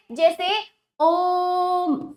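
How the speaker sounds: ओम held three times long, slow and even; drawn-out om. Shown as a demonstration. Teacher showing pupils the pluta vowel, long drawn-out om. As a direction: stretch ओम out like a chant. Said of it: "खींचकर बोला जाएगा".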